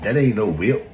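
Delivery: worked-up mimicry of naysayers; imitating indignant skeptics. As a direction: argue it like a stubborn doubter. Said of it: "look at it and be like".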